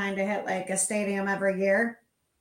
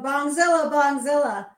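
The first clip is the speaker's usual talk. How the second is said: megaphone call; 4 semitones higher, 5 dB louder than usual. Retold or imitated. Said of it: "saying over the microphone".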